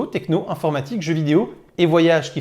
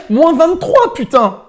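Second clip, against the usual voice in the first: much higher, loud exclamation; excited outburst; 10 semitones higher, 8 dB louder, slower than usual. Real, not acted. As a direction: cry it out, one-upping the cold. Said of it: "je renchérirais".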